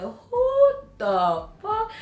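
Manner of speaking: very high, drawn-out question; mock bafflement. Baffled at the name he brought up, high slow disbelief. Acts out her baffled reply.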